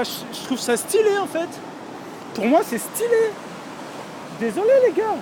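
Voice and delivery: excited. Real, not acted. Excited at the flooded river.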